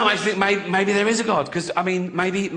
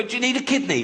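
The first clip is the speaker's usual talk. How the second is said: mock eager dog; 8 semitones higher, faster than usual. Voicing a dog.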